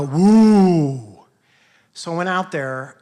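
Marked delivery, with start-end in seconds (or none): 0.0-1.2 crowd cheer. Retold or imitated. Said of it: "I heard the whole crowd go".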